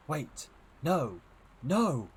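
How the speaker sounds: urgent, rising cries of protest; alarmed cry. Acted.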